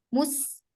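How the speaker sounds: "mus" ditutup dengan sin sukun yang mengalir; contoh sukun. Shown as a demonstration. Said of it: "Karena dia di sini sukun kan". ("mus" dengan sin mati, suaranya mengalir)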